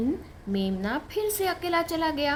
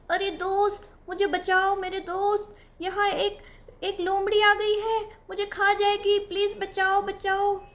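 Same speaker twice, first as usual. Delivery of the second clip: mock cry for help; 9 semitones higher than usual. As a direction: fake a panicked shout for rescue. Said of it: "झूठ मूठ का चिल्लाने लगा". (झूठ-मूठ की ऊँची पुकार)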